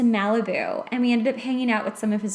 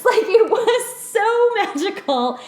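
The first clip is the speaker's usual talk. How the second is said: higher, louder and slow, laughing through it; swooning laugh over shooting stars; 10 semitones higher, 6 dB louder, slower than usual. Real, not acted. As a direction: laugh as you gush about the magic.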